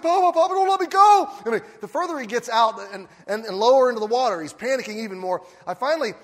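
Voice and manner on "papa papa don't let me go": put-on high, loud pleading child's cry; panicked child. Boy floating in the pool, begging grandpa in panic. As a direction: beg not to be let go.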